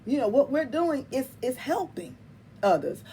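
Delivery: ordinary talk, nothing marked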